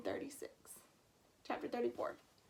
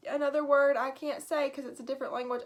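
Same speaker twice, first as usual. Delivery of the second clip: ordinary delivery, nothing marked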